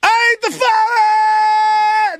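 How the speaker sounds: high, long-held yell; drawn-out shout. Acted. Shouting out in a retold crowd scene, long high holler. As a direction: holler it and stretch the last word.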